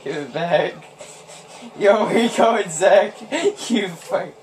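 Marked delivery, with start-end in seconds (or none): none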